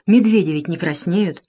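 ordinary delivery

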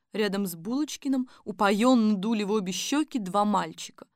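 plain reading voice

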